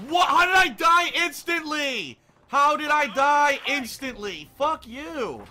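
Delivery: high, loud incredulous shouting; real furious disbelief; outraged yell. Real, not acted. Outraged at instant death.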